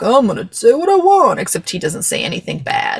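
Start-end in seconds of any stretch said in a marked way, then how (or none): 0.0-1.4 higher, mock defiant declaration; defiant retold outburst. President losing his earpiece and going off script, mock defiant. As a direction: act out his stubborn announcement.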